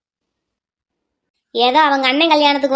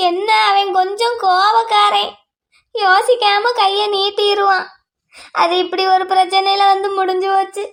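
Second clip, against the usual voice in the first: slow, higher, sing-song weeping; keen the words like a mourning wail; wailing lament; 4 semitones higher than usual. Acted. Mother excusing her hot-tempered son, wailing. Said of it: "நீ முதல்ல அழுகிறத நிறுத்துமா".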